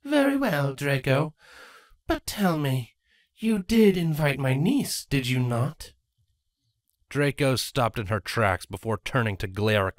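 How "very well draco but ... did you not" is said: higher, sly scheming queen. Acted. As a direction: tease her with a sly question. Crudalis voice.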